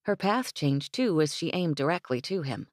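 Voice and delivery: ordinary delivery, nothing marked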